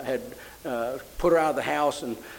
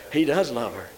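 normal talking voice

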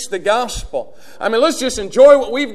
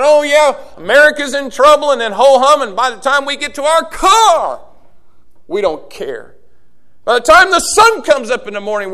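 higher, louder fiery sermon voice; full-force preaching; 6 semitones higher, 6 dB louder than usual. Acted. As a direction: preach it hard to the congregation.

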